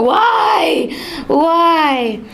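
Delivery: high crying shout of why; hysterical crying. Acted. Distraught merchant wails after the stranger walking away, crying out. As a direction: wail it out like a ruined man. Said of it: "crying in hysterics".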